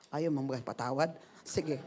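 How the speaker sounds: low, soft retold question; quiet reply. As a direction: let it go calmly, voice dropped. Acting God's calm reply to refusal.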